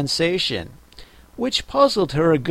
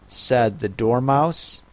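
ordinary delivery

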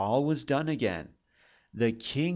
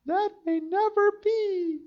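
high maiden voice. A narrator's reading. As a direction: whisper it like a frightened young girl. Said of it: "thought the miller's daughter".

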